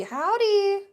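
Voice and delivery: howdy sung up high; cheerful high-pitched howdy; sing-song howdy. Acted. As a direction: greet them with a high, stretched Howdy.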